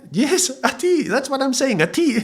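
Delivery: finally understood, a bit exasperated; relieved insisting. Retold or imitated. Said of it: "putting the tongue so far forward".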